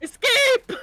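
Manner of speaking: high excited shout; excited yell. Real, not acted. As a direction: yell it out, thrilled. Fleeing burning car.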